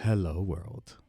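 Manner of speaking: soft, low radio-host voice. Shown as a demonstration. Host shows off his podcast switch, soft radio voice. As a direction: drop into a hushed radio voice. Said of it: "The radio voice".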